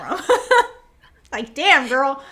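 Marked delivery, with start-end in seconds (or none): 0.2-0.7 burst of laughter. Real, not acted. Laughing at the scene.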